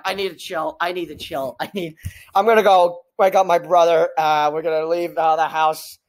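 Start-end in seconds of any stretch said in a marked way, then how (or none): 0.0-2.0 low, softened self-talk; calming oneself down. Real, not acted. Overexcited viewer telling self to chill, calming down. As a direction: let the energy drain as you settle yourself. Talking self down after outburst.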